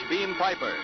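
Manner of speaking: usual speaking voice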